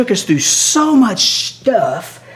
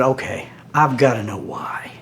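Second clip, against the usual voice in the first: hushed whisper; 5 semitones lower, 5 dB quieter than usual. Retold or imitated. Asking curator quietly why.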